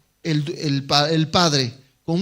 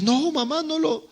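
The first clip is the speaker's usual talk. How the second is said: put-on pleading child; 9 semitones higher, 4 dB quieter than usual. Boy pleading with mother.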